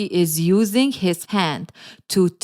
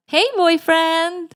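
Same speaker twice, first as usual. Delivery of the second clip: high, drawn-out put-on girlfriend greeting; sing-song high and slow, playing the girlfriend; girlfriend on the phone; 9 semitones higher, slower than usual. Lily greets her boyfriend back, high girlfriend voice.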